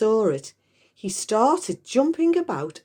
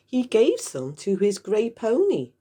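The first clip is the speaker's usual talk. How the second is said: ordinary delivery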